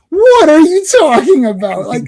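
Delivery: high, loud mock exasperation; exasperated outburst. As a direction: scold deluded believers in comic disbelief. Exasperated rant at believers.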